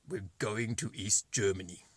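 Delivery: imitating a German captain's accent; lightly put-on German accent. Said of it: "in a German accent".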